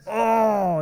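long, loud mock groan on "oh"; exasperated groan. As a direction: groan it out like a stumped kid. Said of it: "and then I groaned".

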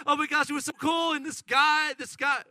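fast, high, loud gushing; excited daughter. Acted. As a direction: rattle it off like a thrilled kid. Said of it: "and they're so excited".

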